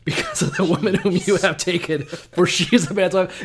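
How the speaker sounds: loud, solemn biblical proclamation, voice pushed up; hammed-up scripture reading of God's threat; booming King James God. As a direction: thunder it like God from on high. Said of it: "But mine goes".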